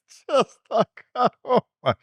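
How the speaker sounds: tearful laugh, voice high and breaking; choked-up laughter, real emotion; emotional laughing. Real, not acted. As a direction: laugh through welling tears. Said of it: "I'm gonna get really emotional".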